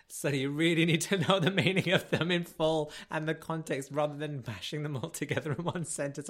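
a mild mock teacher telling off a pupil; a touch of a stern schoolteacher; teacher's scolding. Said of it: "she was just like".

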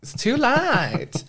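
drawn-out high sing-song. Acted. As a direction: stretch it out, high and playful.

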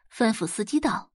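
usual speaking voice

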